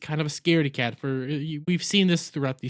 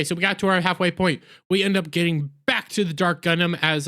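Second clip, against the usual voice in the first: ordinary talk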